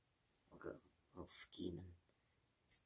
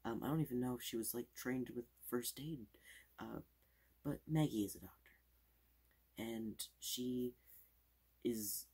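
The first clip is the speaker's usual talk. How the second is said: fast aggressive asmr; 3 semitones higher, 6 dB louder than usual. Acted. Fast loud ASMR showing.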